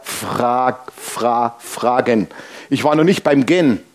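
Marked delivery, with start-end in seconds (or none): 0.0-2.3 "frag-, fra-, fragen" in lower, separated pieces; letter by letter. Shown as a demonstration. Shows how far the word got, "fragen" in broken pieces. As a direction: break "fragen" into halting fragments with gaps. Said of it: "das Wort ging dann mit ein paar Buchstaben weiter".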